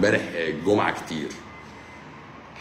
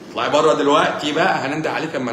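higher, louder playful shooing; mock shout; 7 semitones higher, 4 dB louder than usual. Doctor jokingly throwing a viewer off the live stream, mock shout. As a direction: pretend to throw her out, teasing.

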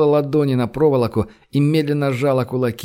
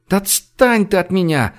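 high, exasperated plea; pleading; 6 semitones higher than usual. A narrator's reading. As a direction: beg to be left alone. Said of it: "взмолилась Женя". (взмолиться высоким голосом)

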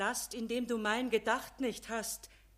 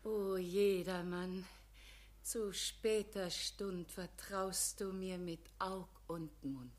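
soft, lower, gentle and weak; tender frail voice; 4 semitones lower, 8 dB quieter than usual. Acted. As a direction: console him softly like a dying saint. Frail figure gently consoles.

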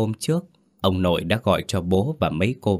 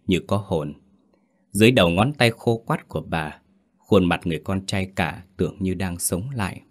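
ordinary delivery

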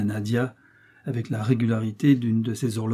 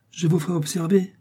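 slightly irritated; 7 semitones higher than usual. A narrator's reading. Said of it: "un peu irrité".

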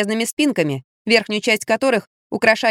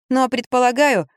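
ordinary delivery

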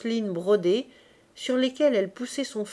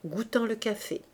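plain reading voice

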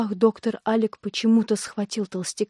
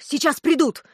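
raised, higher-pitched warning; urgent cry; 6 semitones higher, 4 dB louder than usual. A narrator's reading. Doctor warns accomplice they will be caught, urgent cry. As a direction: hurry him along in alarm. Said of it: "крикнул Алик".

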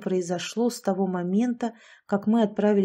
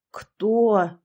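exclaiming; 5 dB louder than usual. A narrator's reading. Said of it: "воскликнул дядя Лёша".

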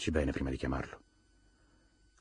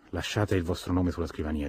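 plain reading voice

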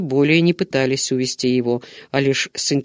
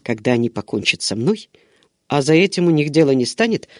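plain reading voice

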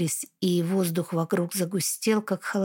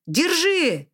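shout; 8 semitones higher than usual. Acted. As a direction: shout it across the hall. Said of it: "крикнул он".

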